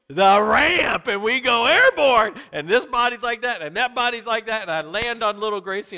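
high, loud, breathless telling; an ER doctor reliving the sled wreck with his daughter; dramatic crash story. Retold or imitated. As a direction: build the crash to a peak.

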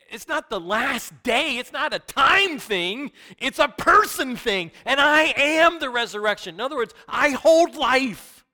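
a preacher driving home Jesus as resurrection; preaching at full force. Acted. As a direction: proclaim it to the room. Preaching climax.

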